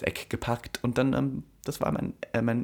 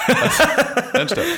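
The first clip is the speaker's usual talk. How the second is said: laughing, much higher protest to stop; 7 dB louder than usual. Real, not acted. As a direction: break up laughing while saying stop. Laughing, stopping the friend.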